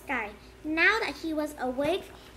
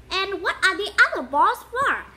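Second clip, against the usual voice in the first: eager; 5 semitones higher, 8 dB louder than usual. Acted. Colin eager question.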